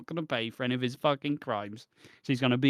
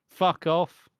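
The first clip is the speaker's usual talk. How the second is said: angry fuck off; 4 semitones higher, 6 dB louder than usual. Real, not acted. Ranting at the character.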